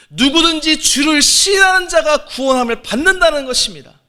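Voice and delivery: high, loud sermon climax ending in a shout; preaching at full force. Acted. A pastor leading his flock in Peter's confession, shouting. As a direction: rouse the congregation to a confession.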